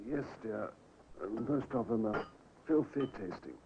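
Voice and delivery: faint, feeble voice from a sickbed; barely able to call out; weak sick man. Acted. Dying husband calling his wife from the sickbed, faint and feeble.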